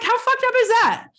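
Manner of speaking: high, loud outraged cry, how fucked up is that; outraged exclamation. Real, not acted. Guest venting to the host about withheld formula information, a high, loud outraged cry. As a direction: burst out in disbelief at the absurdity.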